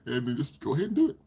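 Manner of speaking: ordinary talk, nothing marked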